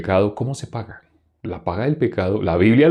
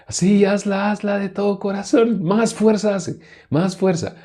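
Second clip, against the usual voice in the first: high, strained egging-on voice; mock urging; 12 semitones higher than usual. Preacher mimics people demanding a heartfelt prayer, mock urging. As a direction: push them on with exaggerated fervor. Parodia a quien exige más fuerza.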